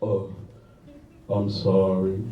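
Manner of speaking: very low, soft imitation; unforgettable man's voice. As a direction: imitate him in a hushed, low register. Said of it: "never forget his voice".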